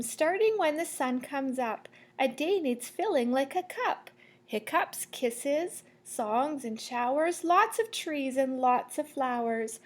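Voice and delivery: lively, rising read of the rhyme, fading at the end; reading to children. Acted. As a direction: build up the list, then settle to sleep. Reads picture book aloud.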